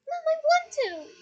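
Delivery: high, loud, slow wail. Acted. Daughter begging her mother, high slow wail. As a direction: wail it like a desperate child. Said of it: "wailed Ginny".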